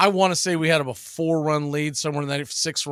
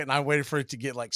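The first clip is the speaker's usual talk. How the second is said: ordinary talk, nothing marked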